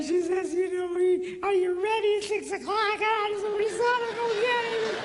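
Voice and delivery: high, mock wife voice. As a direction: put on a high, fussy wife voice for effect. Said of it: "She doesn't sound like that".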